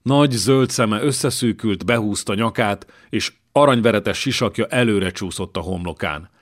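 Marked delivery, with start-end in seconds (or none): none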